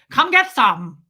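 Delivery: put-on loud German-accented challenge; forceful, punchy German accent; terrifying German accent. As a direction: dare them like a scary German woman. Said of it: "That would be terrifying".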